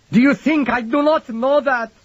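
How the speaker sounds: high, loud broken cry; anguished outburst. A narrator's reading. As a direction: burst out in raw grief. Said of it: "unprepared for the outburst".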